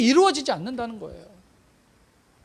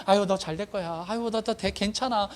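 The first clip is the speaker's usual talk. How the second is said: perfunctory blessing; 2 semitones lower, 3 dB quieter than usual. Acted. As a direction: toss it off as an empty courtesy. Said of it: "인사치레로 그냥". (인사치레로 대충 건네듯)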